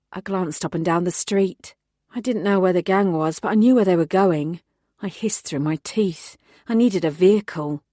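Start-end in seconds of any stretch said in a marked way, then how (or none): none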